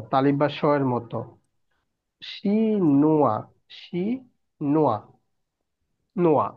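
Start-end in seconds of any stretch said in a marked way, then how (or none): 2.2-5.0 শিনোয়া very slow, syllable by syllable, with a clear "sh"; pronunciation demo. Shown as a demonstration. Teacher shows students how French h sounds, slow pronunciation demo. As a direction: stretch শিনোয়া into "shii... nwa". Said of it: "এইচ এর উচ্চারণ হলো স".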